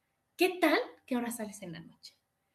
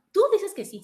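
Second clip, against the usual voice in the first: loud challenge; 8 semitones higher, 7 dB louder than usual. Acted. Acted challenge to Jim Carrey's character.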